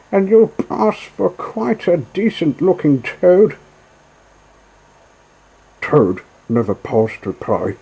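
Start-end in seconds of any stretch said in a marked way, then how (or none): 0.1-3.6 much higher, louder taunting barge woman; jeering call. Acted. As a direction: call out mockingly. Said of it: "she called out".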